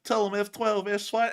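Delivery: high-pitched mock prisoner blabbing; imitating chattering prisoner. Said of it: "He's gonna be telling".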